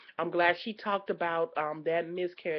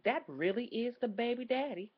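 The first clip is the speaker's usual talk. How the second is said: ordinary talk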